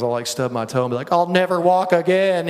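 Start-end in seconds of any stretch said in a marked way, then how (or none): 1.1-2.5 higher, louder hypochondriac wail; mock panic. Speaker mimics himself after stubbing his toe, mock panic. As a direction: overdramatic panicked outburst. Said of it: "just like, just panic".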